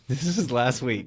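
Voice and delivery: ordinary delivery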